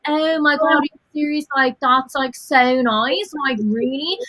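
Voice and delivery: lightly voiced posh south Dublin girl, over dramatic; hint of an over-dramatic posh Dubliner; posh south Dublin accent. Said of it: "And south of Dublin be like".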